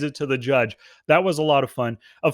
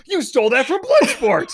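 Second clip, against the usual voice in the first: laughing quote; 7 semitones higher, 4 dB louder than usual. Retold or imitated. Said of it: "someone else said".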